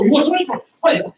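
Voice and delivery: fed up at missing him; mock angry attacker. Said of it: "that makes me angry".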